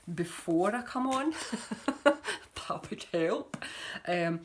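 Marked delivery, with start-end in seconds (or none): 1.4-2.6 short laugh. Real, not acted. Laughs at own disorganisation.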